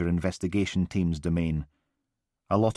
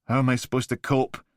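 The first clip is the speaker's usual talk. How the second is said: deflated; 6 semitones higher than usual. Acted. Blakey asking again after his shoulders slump, defeated. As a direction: let the fight drain out. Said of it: "Blakey's shoulders slumped".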